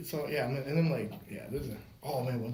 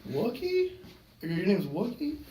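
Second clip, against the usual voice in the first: lightly voiced, higher-pitched questioning; puzzled friendly question; 6 semitones higher, slower than usual. Fireman checking a little boy's name, puzzled. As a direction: ask the child, voice lifting in puzzlement.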